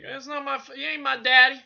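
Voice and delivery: high, loud put-on yell; the son refusing his father, in a high, loud mock yell; mock outburst. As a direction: blurt it like a sulky son.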